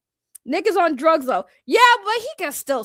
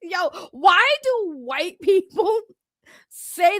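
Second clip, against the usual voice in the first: slow, laughing question; 5 semitones higher, slower than usual. Real, not acted. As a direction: ask it through laughter.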